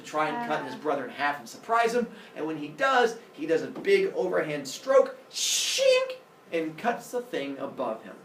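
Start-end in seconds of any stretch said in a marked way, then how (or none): 5.3-6.2 high, loud blade swish; sword slash noise. Acted. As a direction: imitate a blade slicing through. Imitates the sword stroke.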